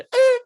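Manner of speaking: short high loud imitated yelp; sharp mock yelp; dog yelp. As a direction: let out a sudden pained dog cry. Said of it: "did the".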